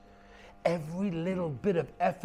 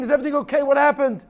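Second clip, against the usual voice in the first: worried father; 11 semitones higher, 10 dB louder, faster than usual. A narrator's reading.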